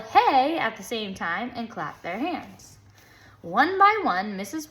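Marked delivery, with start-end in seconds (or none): 0.0-0.6 loud, higher yell of hey. A narrator's reading. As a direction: shout it on the dance's big beat. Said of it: "They all yelled".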